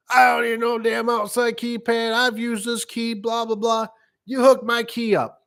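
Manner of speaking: put-on high, slow, grumpy old man; a high cantankerous old-timer; stubborn old man. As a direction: refuse it flat out in a high old voice. Old customer refuses keypad.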